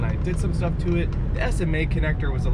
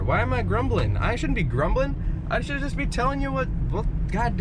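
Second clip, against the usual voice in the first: grumbling rant; 7 semitones higher than usual. Real, not acted. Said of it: "why am I yelling now".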